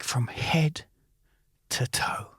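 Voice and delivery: hushed whisper; inner thought whispered. Retold or imitated. Recalls his thoughts about a man at the bar in a whisper. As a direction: whisper it like a secret hate. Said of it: "thinking to myself".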